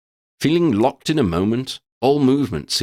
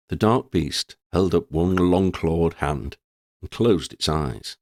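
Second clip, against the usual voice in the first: ordinary delivery, nothing marked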